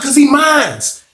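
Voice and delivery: high, slow, hammed-up boast of ownership; slow high mock yell. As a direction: drag out each word, high and slow, like a proud yell. Pooh repeating claim.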